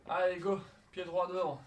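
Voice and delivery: loud workout call; coach command. Real, not acted. Coach calling workout start.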